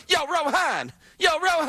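high-pitched mock street call-out; playful, exaggerated calling voice; hammed-up brother. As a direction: holler "yo" like a brother hailing his buddy. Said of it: "You sound like a brother".

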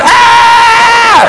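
high, sustained imitated kids' scream; long, high mock shriek; screaming kids. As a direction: scream like a roomful of children. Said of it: "Just kids going".

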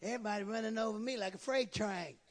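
doubting churchgoer. Acted. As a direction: voice a defeated, murmuring member of the congregation. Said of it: "Y'all sitting there like".

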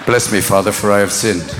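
low hushed confession; confessing sinner. Retold or imitated. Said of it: "confession scene".

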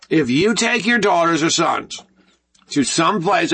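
ordinary talk

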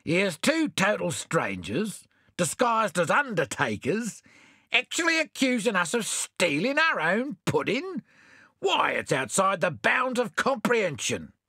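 high, outraged exclamation; indignant Bill. A narrator's reading. Bill exclaims to the strangers, high and indignant. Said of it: "exclaimed Bill".

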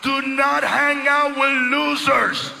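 loud, high shouting sermon; preaching at full force. Acted. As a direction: shout the warning at the congregation.

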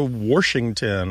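"Washington" said as Warshington; intrusive R. Shown as a demonstration. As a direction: add an R before the sh in "Washington". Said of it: "sometimes you'll hear they go to".

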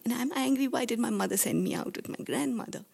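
normal talking voice

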